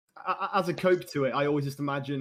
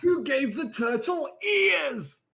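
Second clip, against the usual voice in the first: slow, high mock yell; over-the-top re-enacted outcry; hammed-up shout; 10 semitones higher, 3 dB louder, much slower than usual. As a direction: stretch it into a high, drawn-out yell. Hamming up the quoted clip.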